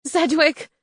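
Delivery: high, loud cry of relief; joyful cry. A narrator's reading. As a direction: call out his name in joy. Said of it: "I cried".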